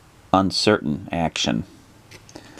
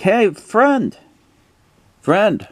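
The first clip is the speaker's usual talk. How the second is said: high, put-on call to a friend; high-pitched friendly character voice; 4 dB louder, much slower than usual. Stranger hailing a friend by the road, high put-on voice. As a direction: hail someone in a high character voice. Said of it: "and it's like".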